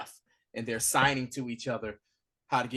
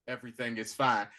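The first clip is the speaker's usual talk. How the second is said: unconvincing asshole voice; 2 semitones lower, 4 dB quieter than usual. Retold or imitated. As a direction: imitate a reluctant, unconvincing reassurance. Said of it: "He has an asshole voice".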